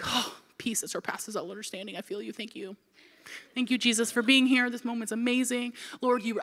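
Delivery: soft, hushed prayer; praying. Retold or imitated. Awed by the lake, praying quietly to Jesus. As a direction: murmur thanks to the Lord softly. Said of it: "I'm praying".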